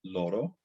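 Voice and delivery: LORO with a single tapped R; LORO, careful attempt at a Spanish tap. Shown as a demonstration. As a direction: tap the R in "loro" once, tongue flicked. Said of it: "That's about as good as I can do".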